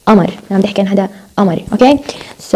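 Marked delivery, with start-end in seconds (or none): 0.1-0.3 قمر with a glottal stop for qaf; dialect variant. Shown as a demonstration. Teacher showing a dialect, قمر opening on a glottal stop. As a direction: drop the qaf in قمر to a catch. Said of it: "هاي حسب اللهجات".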